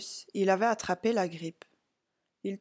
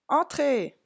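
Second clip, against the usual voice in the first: raised, higher call; 6 semitones higher, 4 dB louder than usual. A narrator's reading. Clown answers a knock at his caravan, calling out. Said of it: "cria Antonio".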